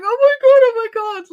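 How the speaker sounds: high, loud, breathless oh my God; excited squeal. Retold or imitated. Recalling the night the channel hit five K, excited squeal. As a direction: relive the thrill of hitting the goal. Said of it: "I was just like".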